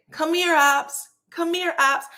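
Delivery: high sing-out, as if summoning a dog; calling a dog. Retold or imitated. Shanita calls out to Tisha like she's a dog, high call. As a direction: call them over like a pet. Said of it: "calls out to Tisha like she's a dog".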